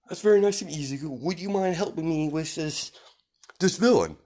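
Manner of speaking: high, hearty superhero voice; friendly hero. Acted. All Might asking Izuku to help, high and friendly. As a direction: ask for help in a genial hero's voice.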